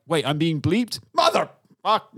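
mock-indignant Deadpool outburst; mock outrage. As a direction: catch yourself being bleeped and explode. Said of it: "go like".

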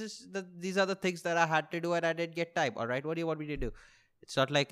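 ordinary delivery

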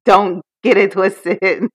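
slow, drawn out through laughter; laughing warning. Real, not acted. Proud woman playfully warning doubters, laughing. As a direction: laugh as you warn them.

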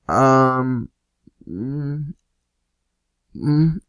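halting hums that creep upward; mock hesitation. Friends stuck for an answer to the cop, imitated hesitation. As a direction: stall as if hunting for an excuse.

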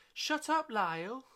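very high, loud squeal on both words; high squeaky. Shown as a demonstration. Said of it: "can you say it like this?".

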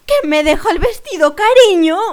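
very loud, high mock panic; shouting. Older woman to a stranger about her forgotten dress, shouting. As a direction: yell it at a stranger as if disaster struck. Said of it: "me mira y, y grita".

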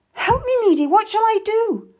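high, loud cry for help; terrified, high-pitched pleading; panicked plea. A narrator's reading. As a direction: beg desperately for help. Frightened mother begs for help.

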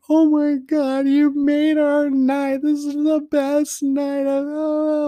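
very high, drawn-out mock squeal; crying and excited. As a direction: imitate an overjoyed family squealing through tears. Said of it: "crying and all excited".

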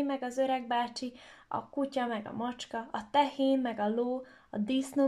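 plain reading voice

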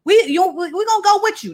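high, eager tagalong voices; eager disciples. Acted. Disciples begging Peter to take them fishing, high and eager. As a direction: chime in like eager kids begging to come. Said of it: "they all said".

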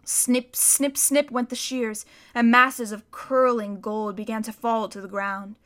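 ordinary delivery

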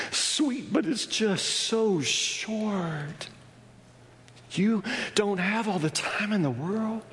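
high, ringing pulpit voice building up; fervent sermon climb; preaching at full force. Acted. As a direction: preach it out big to the congregation.

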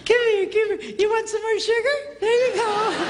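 high, gleeful coaxing voice; doting grandparent. Acted. Grandparent plying grandchildren with sugar as payback, high and gleeful. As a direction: coax the kids in a high, eager pitch.

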